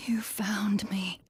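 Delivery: hushed, breathless whisper to the one who came for her; barely voiced, as if afraid to be overheard. Trapped AI whispering to her rescuer, hushed whisper. Cortana trapped, calling to Chief.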